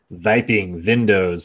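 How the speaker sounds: slow Viping windows, v for w, Chekhov-style; mock Russian accent. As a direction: say Viping windows like Chekhov from Star Trek, very slowly. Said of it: "more like a Chekhov thing".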